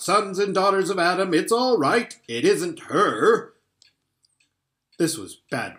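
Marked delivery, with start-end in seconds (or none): 0.0-3.5 loud excited shout. Acted. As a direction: call out with delight. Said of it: "he was shouting".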